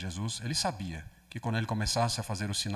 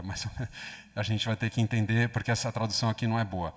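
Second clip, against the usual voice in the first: ordinary delivery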